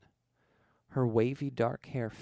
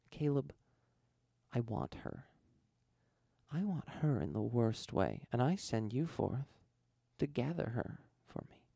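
hushed desire; 5 dB quieter than usual. A narrator's reading. The First covets the girl.

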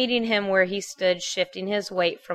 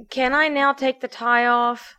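higher-pitched, mildly pleading request; a touch of pleading, pitched up; 6 semitones higher than usual. A narrator's reading. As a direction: ask as if begging a small favour. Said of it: "pleading with her eyes".

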